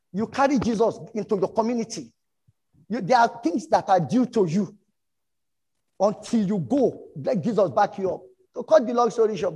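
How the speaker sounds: loud, high, driving sermon push; rally the church hard; fiery preaching. Real, not acted. Pastor urging his church into their community, loud and high.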